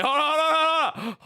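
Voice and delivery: high, loud, urgent hold on; excited hold on. Real, not acted. Streamer to chat, high loud urgent hold on. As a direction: stop everything, something's coming.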